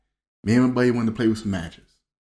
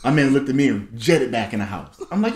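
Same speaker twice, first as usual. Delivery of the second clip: normal talking voice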